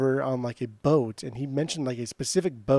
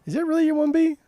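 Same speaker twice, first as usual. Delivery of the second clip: surprised; 3 dB louder, faster than usual. Real, not acted. Friend's shock at the pick.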